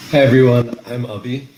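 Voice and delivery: ordinary talk, nothing marked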